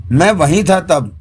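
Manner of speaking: ordinary delivery, nothing marked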